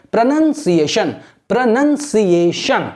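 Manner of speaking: प्रोनंसिएशन very slowly, split into syllables with "nun" second; slow repeated model of प्रोनंसिएशन, "nun" not "noun"; pronunciation drill. Shown as a demonstration. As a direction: drill प्रोनंसिएशन, stretching every syllable for learners to copy. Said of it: "ऐसे करके बोलना है आपको".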